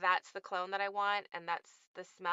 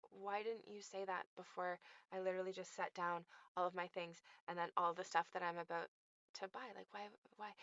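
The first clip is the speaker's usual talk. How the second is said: softer, exasperated mock question; muttered exasperation; 10 dB quieter, faster than usual. Shopper mutters at rude clerk, softer and exasperated. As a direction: mutter it under your breath in disbelief. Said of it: "And I'm like".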